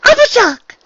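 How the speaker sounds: very high, loud delighted cry; exclaimed. Acted. Unicorn greets her friend at the door, exclaiming. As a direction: exclaim the friend's name in one burst. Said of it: "The unicorn exclaimed".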